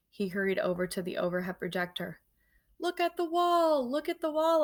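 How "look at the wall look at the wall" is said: high squeaky urgent squeal; hamster squeak. Acted. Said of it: "I squeaked".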